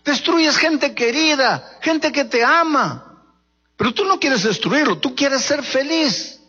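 high, slow pleading sermon voice; fervent preacher at full force; impassioned preaching. Acted.

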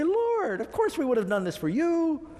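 high, indignant "Lord" pleading innocence; high-pitched wounded protest; protesting disbelief. Retold or imitated. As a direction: act shocked that you'd ever neglect him. Congregation protests to Jesus.

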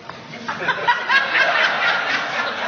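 high breathy mocking laugh; mock cackle. Preacher mocking gloomy church members with a laugh. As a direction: scoff with an airy laugh.

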